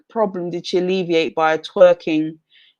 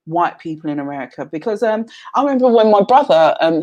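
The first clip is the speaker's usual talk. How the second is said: usual speaking voice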